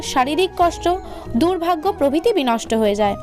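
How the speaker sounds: plain reading voice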